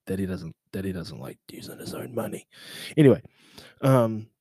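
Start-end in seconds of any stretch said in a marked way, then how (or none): 0.1-2.5 hushed joking whisper aside. Retold or imitated. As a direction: drop to a secret whisper about daddy. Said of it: "whispered aside".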